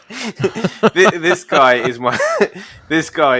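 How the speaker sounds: talking through giggles; cracking up mid-sentence, restarting; laughing. Real, not acted. Telling co-host about his spirit animal, laughing.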